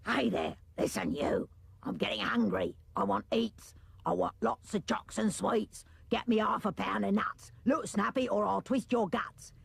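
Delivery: odd, rough creature voice, half whispered; tummy beast bullying the boy for food; bossy tummy beast. Acted. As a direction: demand sweets like a greedy little monster. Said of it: "It shouted".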